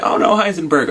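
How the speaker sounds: high-pitched mock dismay; playful high-voiced dismay; acted dismay. Acting dismay at character's death.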